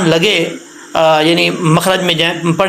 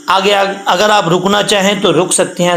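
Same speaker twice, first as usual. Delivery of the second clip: ordinary talk, nothing marked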